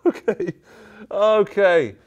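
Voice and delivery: high, loud, giggly okay; laughing. Real, not acted. Joker reacting to his own boast, laughing. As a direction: crack up at your own joke.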